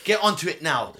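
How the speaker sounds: insistent CEO. Retold or imitated. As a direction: push the assistant to act now. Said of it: "And he's like".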